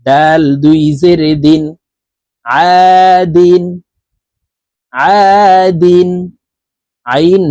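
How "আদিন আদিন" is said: আদিন drawn out slowly, each sound held; slow drawn-out recitation. Shown as a demonstration. Teacher drawing out আদিন for the student. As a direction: chant আদিন long and slow like Quran reading. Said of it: "আইন আলিফ জবর আ".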